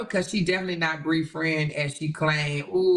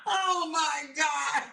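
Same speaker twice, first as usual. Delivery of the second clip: laughing; 9 semitones higher, 4 dB quieter, much slower than usual. Real, not acted. Still laughing at chat.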